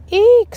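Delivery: high shrill eek; scream. A narrator's reading. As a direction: shriek in fright. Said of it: "screamed Alexia".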